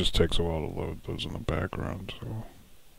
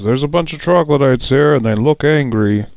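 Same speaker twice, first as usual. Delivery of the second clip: loud, high warning to the party; Tony warns the others before battle, loud and high; 6 semitones higher, 9 dB louder than usual. Acted. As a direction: call out the danger to the group. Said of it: "Tony turns and says".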